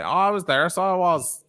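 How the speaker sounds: high-pitched imitation of Northern Irish speech; put-on Northern Irish accent. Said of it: "that whole other accent".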